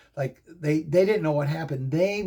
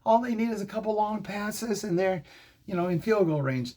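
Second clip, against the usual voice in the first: urgent protest; 7 semitones higher than usual. Retold or imitated. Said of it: "I'm like".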